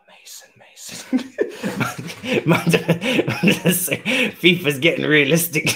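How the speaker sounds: words breaking up into laughs; real laughing fit through the words; helpless laughter. Real, not acted. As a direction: try to talk while cracking up. Cracking up at the miss.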